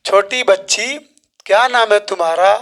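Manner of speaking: ordinary delivery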